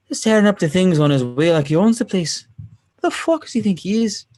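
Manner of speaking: high, animated Irish accent. Shown as a demonstration. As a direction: rant in a put-on Irish brogue. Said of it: "I've got a great Irish accent".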